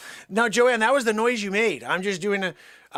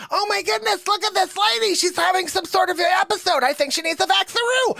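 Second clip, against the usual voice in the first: very high, frantic put-on nurse voice; mock alarmed nurse; 13 semitones higher than usual. Different nurse finds Joanne collapsed, high mock panic. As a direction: exclaim in hammed-up panic, pitched way up. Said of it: "different nurse this time".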